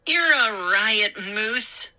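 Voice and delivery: sarcastic. A narrator's reading. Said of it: "Mindy declared".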